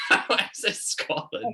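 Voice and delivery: slow, breathy laugh through the words; laughing question. Real, not acted. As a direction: ask it while laughing.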